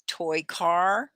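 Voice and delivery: usual speaking voice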